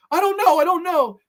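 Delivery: loud, very high frantic cry; panicked pleading. Acted. Allie desperate before the killer, panicked pleading.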